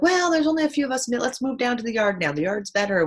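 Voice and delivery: quick, high, loud friendly chatter; imitating the ladies. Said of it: "they're like".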